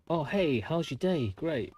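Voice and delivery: high, sugary fake-friendly voice; mock spammer. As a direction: mimic an overly chirpy salesperson. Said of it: "when they come on and go".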